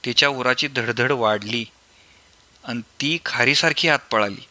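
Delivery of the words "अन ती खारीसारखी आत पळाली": ordinary delivery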